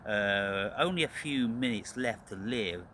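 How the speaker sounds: ordinary talk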